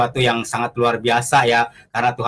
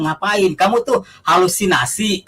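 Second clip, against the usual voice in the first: imitated friend, pitched up in disbelief; incredulous mock scolding; 6 semitones higher than usual. Friend doubting his vision, mock scolding. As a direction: lightly scoff in a higher voice.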